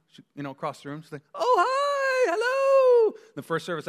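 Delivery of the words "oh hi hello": loud, very high singsong greeting; hammed-up wife's delighted phone voice; wife answering phone. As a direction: put on an over-the-top phone hello, pitched way up. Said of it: "jumps up and answers the phone".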